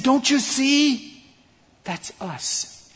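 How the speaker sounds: high, slow "Don't you see?" then hushed "That's us."; excited revelation. Retold or imitated. Scientist revealing his point to the preacher, excited then hushed. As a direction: reveal the point with a thrill, then drop to a hush. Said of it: "And he said".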